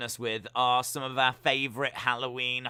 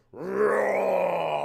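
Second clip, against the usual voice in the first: louder mock lion roar; a hint of a real lion; 2 semitones higher, 5 dB louder than usual. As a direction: growl it like a lion. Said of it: "like a proper lion".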